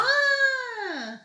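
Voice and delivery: sudden high, loud "Ah!"; a sharp yelp of surprise; startled cry. Real, not acted. Friend yelps in surprise at the carroty Lego piece, high and loud.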